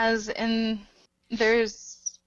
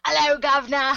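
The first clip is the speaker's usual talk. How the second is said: louder, higher hammed-up Cockney greeting; awful Cockney accent; 4 semitones higher, 6 dB louder than usual. Co-host reluctantly demonstrating on request, exaggerated Cockney accent. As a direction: belt out a bad Eliza Doolittle Cockney accent. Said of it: "doing the Cockney accent".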